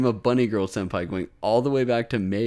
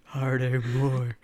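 normal talking voice

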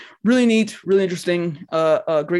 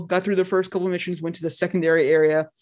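normal talking voice

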